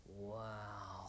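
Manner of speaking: whispered low in awe; awed whisper. A narrator's reading. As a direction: breathe it in wonder. David's awed inner thought.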